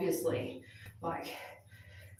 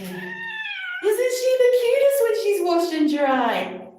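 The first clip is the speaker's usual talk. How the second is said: gushing coo; 11 semitones higher, 4 dB louder, slower than usual. Real, not acted. Owner admiring her freshly washed cat, gushing coo. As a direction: coo adoringly at a pet, pitched way up.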